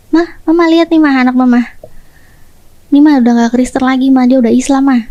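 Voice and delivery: ordinary talk, nothing marked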